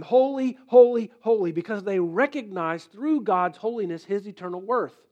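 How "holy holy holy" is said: ringing cry of holy holy; angels crying out. Acted. Preacher voicing the angels' cry, loud. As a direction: call it out like the angels. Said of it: "the angels cry all the time".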